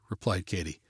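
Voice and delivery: ordinary delivery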